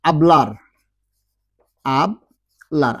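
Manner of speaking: আবলার said with a silent h, as ablar. Shown as a demonstration. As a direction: pronounce আবলার as ablar, no h sound at the start. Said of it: "এখানে আবলার বলেছি কিন্তু হাবলার বলিনি".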